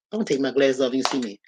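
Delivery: ordinary talk, nothing marked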